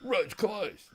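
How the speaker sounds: high, mocking road-worker voice. Telling his mate about the road worker who stopped him, high mocking voice. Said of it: "She jumps out of this big four-wheel drive and goes".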